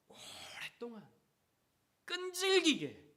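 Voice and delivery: slow, rising to a high loud cry; drawn-out emphasis. Real, not acted. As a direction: stretch each word, then cry out. Sermon climax on persistence.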